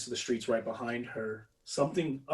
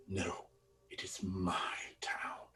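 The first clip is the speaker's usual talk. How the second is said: slow, soft, breathless whisper; whispered menace; 4 semitones lower, 11 dB quieter, slower than usual. Acted. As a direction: breathe it right into their faces. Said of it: "he goes".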